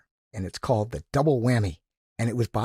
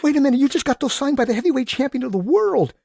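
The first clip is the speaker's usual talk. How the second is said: high, loud, urgent objection; mother pleading with the kid to stop; worked-up alarmed mother; excited protest; 14 semitones higher, 8 dB louder than usual. Retold or imitated. Mother protests son signing gloves.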